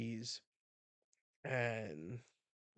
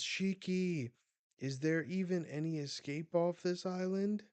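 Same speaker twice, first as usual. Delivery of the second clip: high, raised character voice; acted urgent question; 7 semitones higher, 3 dB louder than usual. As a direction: call out anxiously, play-acted. Desperate character calling to Shiki.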